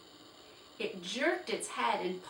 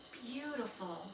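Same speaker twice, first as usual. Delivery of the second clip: soft, hushed awe; awed whisper; 3 semitones higher, 7 dB quieter than usual. Acted. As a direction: breathe it in wonder. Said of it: "whispered Felicity".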